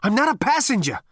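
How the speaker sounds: breathless, high, loud protest; out of breath and desperate; panting. A narrator's reading. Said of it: "panted Zaphod".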